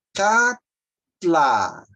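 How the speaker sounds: Catla split in two, long emphatic LA; stress on final LA. Shown as a demonstration. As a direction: teach Catla with a strong ending LA. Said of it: "a sílaba forte tá aqui, ó, lá".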